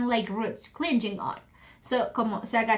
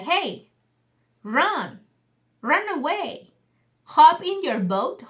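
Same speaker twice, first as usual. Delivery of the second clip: raised, urgent call to flee; urgent warning; 4 semitones higher, 5 dB louder, slower than usual. Retold or imitated. Bird warns Oliver to run away, urgent raised call. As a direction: push him to run, a bit louder and higher. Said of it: "the bird is telling Oliver, like".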